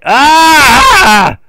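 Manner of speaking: loud, high scream; sudden high yell of alarm; startled scream. Real, not acted. Gamer's vehicle flips over, a loud startled scream.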